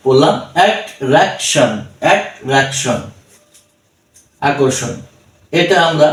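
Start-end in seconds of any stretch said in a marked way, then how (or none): 0.6-3.0 অ্যাট্রাকশন slowly split into অ্যাট, রাক, শন; slow অ্যাট্রাকশন with gaps between syllables; three-syllable pronunciation. Shown as a demonstration. Teacher reading in three syllables, অ্যাট্রাকশন slow and broken up. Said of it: "এই শব্দটা আমরা তিন সিলেবলে ভেঙে".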